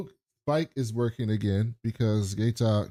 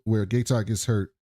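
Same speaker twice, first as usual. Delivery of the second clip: usual speaking voice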